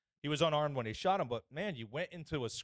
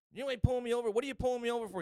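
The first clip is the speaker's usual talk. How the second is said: high-pitched mock motorist arguing back; high, louder mock outrage; jibber-jabbing protest; 11 semitones higher, 4 dB louder, faster than usual. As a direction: imitate her indignant jabbering, pitched way up. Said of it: "jibber jabbing at him".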